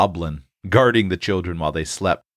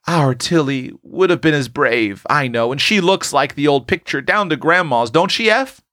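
higher-pitched voice of a proud younger sister; admiring little sister; 6 semitones higher than usual. A narrator's reading. Prue praising her brave sister to Eph, admiring. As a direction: cry it out with admiration, lifting high on the closing question. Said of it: "cried Prue, who admired her bold, bright sister".

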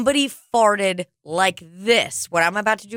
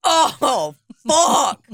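mock outburst; 6 semitones higher than usual. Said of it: "somebody's just like".

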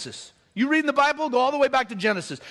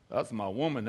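mock macho husband; 12 semitones lower, 9 dB quieter than usual. Mocking a possessive husband.

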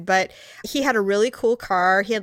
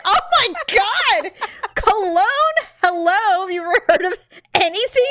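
mock horror; 9 semitones higher, 4 dB louder than usual. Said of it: "And I'm like".

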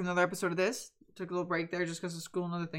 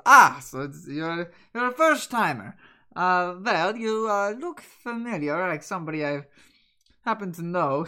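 laughing Swindler; 8 dB louder than usual. Acted. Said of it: "He laughs and goes".

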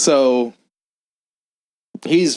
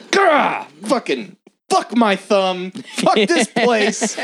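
mock pained outburst; 10 semitones higher, much slower than usual. Hurts thumb on slicer.

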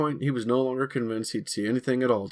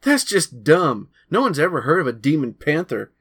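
scornful; 6 semitones higher, 8 dB louder than usual. Acted. Boys arguing about the creature.

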